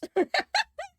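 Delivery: sudden very high squeal of laughter. Real, not acted. Laughs at no difference.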